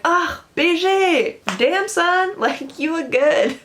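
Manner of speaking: high, drawn-out admiring whoop; impressed. Acted. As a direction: hype up a friend who cleaned up well. Acted admiring friend's great look.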